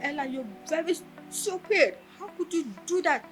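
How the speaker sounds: high-pitched scolding without shouting; imitated angry lecturer. Angry lecturer berating a student over her test, retold high-pitched scolding. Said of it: "You were angry".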